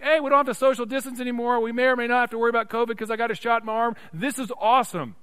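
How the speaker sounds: high, loud, rapid-fire chatter; mock excitement. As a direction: gush in a rush like a thrilled vaccinated person. Voices people thrilled by vaccine.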